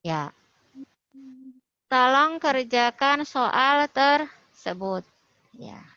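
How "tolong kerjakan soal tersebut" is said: slow, louder command on "Tolong kerjakan soal tersebut"; command intonation. Shown as a demonstration. Teacher modelling a student's example sentence, slow loud command intonation. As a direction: give "Tolong kerjakan soal tersebut" as a firm, drawn-out order. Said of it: "nadanya agak sedikit naik".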